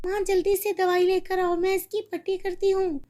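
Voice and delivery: put-on high, urgent child voice; girl asking mother for medicine; eager girl. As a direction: hurry to help the bird. Girl tends pigeon.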